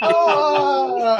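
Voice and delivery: high loud playful yelp as the spinning fish; mock creature cry. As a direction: yelp like a fish swirling in a toilet.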